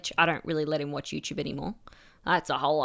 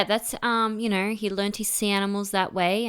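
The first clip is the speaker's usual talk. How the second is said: ordinary talk, nothing marked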